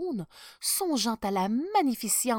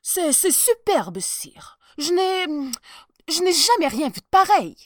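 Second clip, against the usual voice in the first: higher, louder nervous stutter; trembling stammer; 9 semitones higher, 12 dB louder than usual. Acted. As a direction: lie through fear. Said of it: "tout tremblant et prêt à pleurer".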